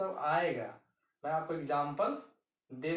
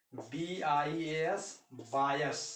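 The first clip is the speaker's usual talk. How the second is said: slow; much slower than usual. Shown as a demonstration. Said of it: "spelling example slowly".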